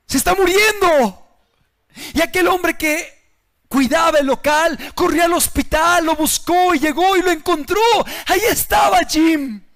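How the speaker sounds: full-force sermon voice; fiery preaching. Acted. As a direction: drive the warning home from the pulpit.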